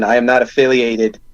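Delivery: ordinary talk, nothing marked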